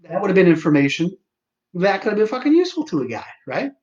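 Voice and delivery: patient scolding the doctor for missing information; sarcastic retort. Retold or imitated. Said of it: "I said".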